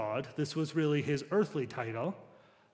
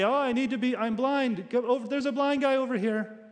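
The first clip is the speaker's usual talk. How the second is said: higher-pitched, louder plea for attention; mock calling out; 8 semitones higher, 4 dB louder than usual. Imagined blind man calling to passers-by, mock pleading shout. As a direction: act out a flustered call for help.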